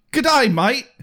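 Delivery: cheery outback greeting; broad Aussie "g'day mate"; Australian accent. Pretend. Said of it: "That's better".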